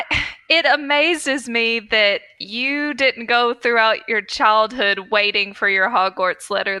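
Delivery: usual speaking voice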